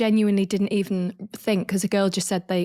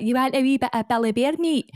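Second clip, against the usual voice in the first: higher-pitched Scottish mum voice; imitated Scottish accent; 5 semitones higher than usual. Mum offers the boys Billy Bear meat, imitated Scottish accent. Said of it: "obviously my mum's Scottish".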